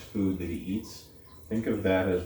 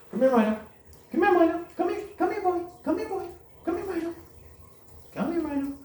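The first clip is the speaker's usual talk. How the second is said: calling the puppy; 4 dB louder, faster than usual. Real, not acted. Calling puppy across distance.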